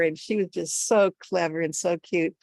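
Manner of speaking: ordinary delivery, nothing marked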